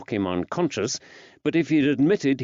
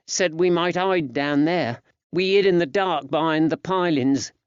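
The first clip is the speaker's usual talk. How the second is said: put-on high-pitched boy telling his story; little lad's higher voice; frightened young lad; 7 semitones higher than usual. A narrator's reading. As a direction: voice a small boy recounting an escape. Narrator voices young Georgie.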